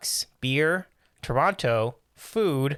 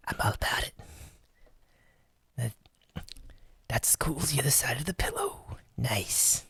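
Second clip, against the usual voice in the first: slack jawed dopey whisper; Dopey Bumbles whisper; 2 semitones lower, 8 dB quieter than usual. Pretend. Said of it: "the Whispering is a, is an alt character".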